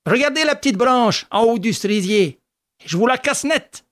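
put-on a cocky young man, pitched high; boastful eldest son. A narrator's reading. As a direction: show off to his brothers. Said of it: "Et il dit à ses frères". (fils aîné vantard, voix haute)

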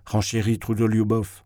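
ordinary delivery

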